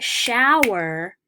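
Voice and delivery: SHOWER with the first syllable punched high and loud; stress on first syllable. Shown as a demonstration. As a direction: lean hard on the start of shower, let the end drop. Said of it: "the stress is on the first syllable".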